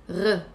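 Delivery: short "R" gargled at the back of the throat. Shown as a demonstration. Teacher showing students the GH, "R" gargled. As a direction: say "R" as a brief gargle. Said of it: "Gargling is G H".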